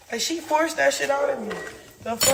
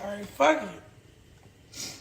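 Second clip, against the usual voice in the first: resigned give-up; 4 semitones lower, 4 dB quieter, much slower than usual. Retold or imitated. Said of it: "So I said".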